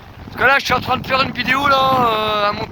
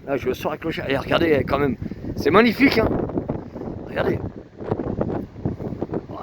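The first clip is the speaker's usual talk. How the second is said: ordinary delivery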